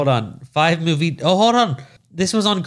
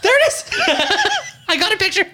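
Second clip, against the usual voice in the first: laughing. Real, not acted. Laughing while searching for picture.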